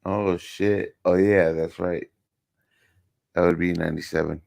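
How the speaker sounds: ordinary delivery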